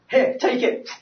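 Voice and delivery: high-pitched, acted urgency; mock-panicked blurt; retold panicky handoff. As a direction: thrust it at him, ready to bolt. Retold hasty handoff before fleeing.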